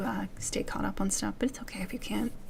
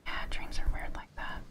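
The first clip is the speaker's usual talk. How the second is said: whispering. Real, not acted. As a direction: breathe it close to the mic. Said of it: "a whisper session in an A S M R".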